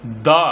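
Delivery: दा with a short open a sound; दा as in "the apple" before a consonant. Shown as a demonstration. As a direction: pronounce दा as "da", not "dee". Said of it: "pronounce कीजिएगा".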